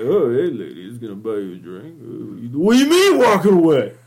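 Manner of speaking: mocking a sleazy guy hitting on a woman; douchebag bar guy. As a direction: play a pathetic bar creep. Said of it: "the douchebag bar scene where it's all like".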